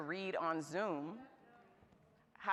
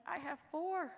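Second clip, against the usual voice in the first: higher, mock-exasperated; incredulous squeak; 10 semitones higher than usual. Real, not acted. Telling the audience she has four kids, mock exasperated.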